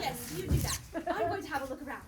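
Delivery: loud, laughing, grandly dismissive; lady inventor, amused. Acted. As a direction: wave off the assistant with a chuckle. Inventor character speaks to assistant.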